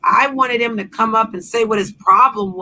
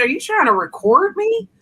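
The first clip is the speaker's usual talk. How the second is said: incredulous; 12 semitones higher than usual. Retold or imitated. Replaying her reply to his late call, high incredulous. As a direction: pitch jumps far higher in disbelief. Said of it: "I said".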